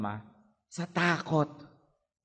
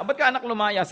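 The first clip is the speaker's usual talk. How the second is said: upset parent; 9 semitones higher, 4 dB louder than usual. Retold or imitated. Parent asks child why.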